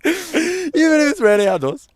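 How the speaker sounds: high, loud hammed-up Caribbean accent. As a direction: squeal the line in a mock Caribbean accent. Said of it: "in a Caribbean accent".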